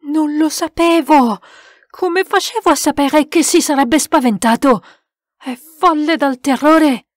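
high, loud, distraught; desperate protest. A narrator's reading. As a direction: plead your innocence in alarm. Desperate self-defence after accusation.

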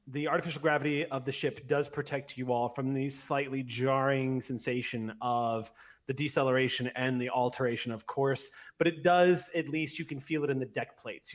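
ordinary talk, nothing marked

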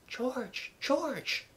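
very soft, high woman's voice; whispered plea. A narrator's reading. Wife tries to stop her husband, whispered. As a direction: whisper his name in hushed appeal. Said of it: "Missus Darling whispered".

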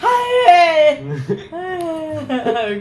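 loud laughing cry of pain. Real, not acted. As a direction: laugh through the sting. Slapped hard in the game.

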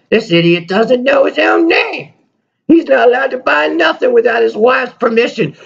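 high, squeaky puppet voice mocking the owner; a bratty puppet insulting its owner. Puppet Dave takes over the show and insults the host, squeaky. Dave, you gotta stop that.

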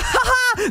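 high taunting ha-ha-ha; mocking laugh. Real, not acted. At the hejter failing the jump, a high mocking laugh.

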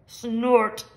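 snorted word in a different voice; machine snort. A narrator's reading. The big digger answers the bird with a snort.

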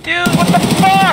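angry shout. Real, not acted. Angry after dying.

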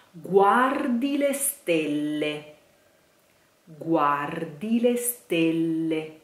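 guardi le stelle slowly, stelle with a long double L; slow model line. Shown as a demonstration. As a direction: dwell on the LL of stelle. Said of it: "saying stelle".

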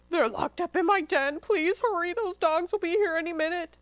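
high, panicky fox voice; urgent pleading. A narrator's reading. Fox confesses where the piglets are, pleading urgently. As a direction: blurt it out urgently.

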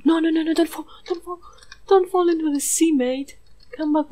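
high, loud panicked no no no; frantic cry to the goat; panicked pleading. Real, not acted. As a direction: beg him not to fall. Goat falling off boat.

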